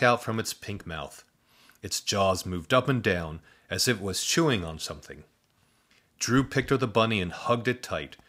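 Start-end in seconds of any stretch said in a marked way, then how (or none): none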